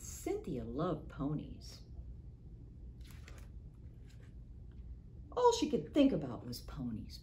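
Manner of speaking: put-on expressive read-aloud for kids; story reading. A narrator's reading. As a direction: read it to children, with feeling. Librarian reads picture book aloud.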